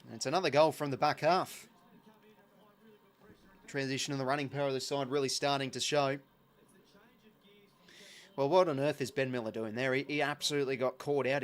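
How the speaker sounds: steady live footy call; play-by-play commentary. Acted. Streamer calling the footy match for viewers, play-by-play. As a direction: call the passage of play as it happens.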